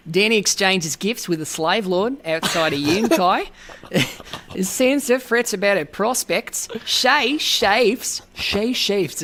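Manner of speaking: put-on Australian accent read; lightly voiced Australian accent. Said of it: "my Australian accent".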